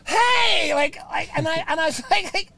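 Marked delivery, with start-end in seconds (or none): 0.0-0.7 high loud greeting; rally the crowd; rock-show shout; stage hype. Retold or imitated. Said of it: "go on stage and go".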